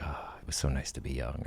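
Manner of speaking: soft, whispered fond memory; wistful whisper. Retold or imitated. Elderly couple watching their young selves, a soft whisper. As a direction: sigh it like old lovers looking back. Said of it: "they're like".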